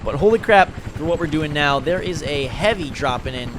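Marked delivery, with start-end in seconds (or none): 0.2-0.7 loud excited burst; loud exclamation. Real, not acted. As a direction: blurt it out loud. Exclaiming about the game.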